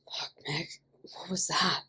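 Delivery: soft, rough urgent whisper; harsh whisper. A narrator's reading. Ian demanding answers from Mickey in a harsh whisper. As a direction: hiss it so nobody else hears. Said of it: "Ian demanded in a harsh whisper".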